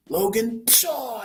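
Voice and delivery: hammed-up stab cry; mock shout and stab noise. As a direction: burst out the name then a stabbing pshaw. Acting out the stabbing scene.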